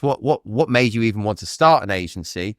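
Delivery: normal talking voice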